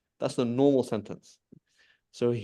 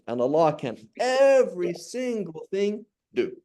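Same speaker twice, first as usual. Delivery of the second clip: big raised emphasis on "every single thing"; emphatic; 7 semitones higher than usual. Shown as a demonstration. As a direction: hammer "every single thing", high and stretched. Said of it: "changed to emphasize that".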